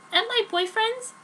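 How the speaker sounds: Joan's voice. Acted. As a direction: pitch her up as the teen heroine.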